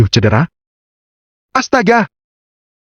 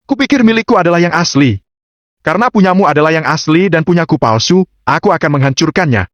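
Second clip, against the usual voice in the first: confident challenger in a higher voice; Agam; 6 semitones higher than usual. A narrator's reading. Agam threatens to destroy his supposedly fake stone, challenging. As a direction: pitch it up for the bluffing challenger.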